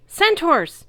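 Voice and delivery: high loud alarmed yell; sharp high yell of surprise. A narrator's reading. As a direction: shout it out across the battle. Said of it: "Annabeth yelled".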